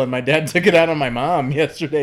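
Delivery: loud mock-child announcement; a hint of a child telling on dad; imitating a child. Voices child tattling to a stranger.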